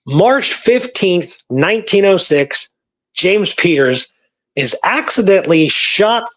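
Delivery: ordinary delivery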